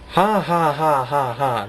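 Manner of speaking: hearty hero laugh fading down; a heroic laugh, not an evil one; good-guy laugh. Shown as a demonstration. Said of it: "There, that's how I laughed".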